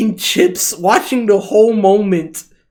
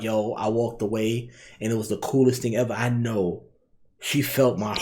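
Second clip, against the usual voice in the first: much lower and softer, smug mock boast; bragging teen boy; 10 semitones lower, 12 dB quieter than usual. Imagining the boy boasting at school, mock smug. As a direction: play the boy showing off to friends. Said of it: "He probably bragged about it".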